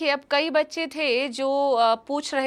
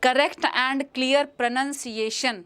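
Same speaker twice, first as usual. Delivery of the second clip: each word spelled out slowly and clearly; teacher models careful diction for students, slowly; slow careful demo; much slower than usual. Shown as a demonstration. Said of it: "spellings के लिए एक चीज़ पे ध्यान".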